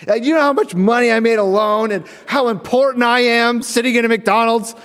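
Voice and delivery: high, loud boastful rant; mock-outraged, full of self-importance; irate lawyer. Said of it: "full front ire of this man".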